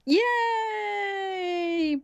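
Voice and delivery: high, drawn-out cheer; celebratory cheer. Real, not acted. Host cheering the panel's right quiz answer, celebratory. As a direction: let out a long, high-pitched yay.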